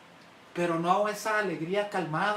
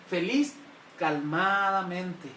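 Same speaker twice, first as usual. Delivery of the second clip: calm and slow; 2 semitones lower, much slower than usual. Shown as a demonstration. Preacher to the congregation, slow calm delivery. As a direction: show the calm joy with a slow calmadamente. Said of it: "La palabra jairos significa ser feliz".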